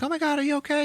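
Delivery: high-pitched mock worried girl; acted-out high, anxious check on a friend; alarmed concern. Girl calling to a fallen friend, mock alarmed.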